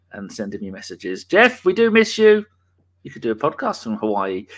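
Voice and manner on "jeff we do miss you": playful raised-voice shout-out; mock calling out. Host to Jeff away in Hawaii, mock calling out. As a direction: call out high and loud to an absent mate. Calling out to absent podcast friend.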